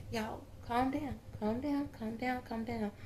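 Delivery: hushed calming. Real, not acted. Medium hushing spirits who all chime in, hushed and calming. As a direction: quietly soothe a noisy crowd.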